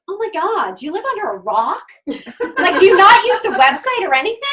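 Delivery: mock snide scorn. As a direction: belittle the clueless newbie. Snide girl mocks newcomer.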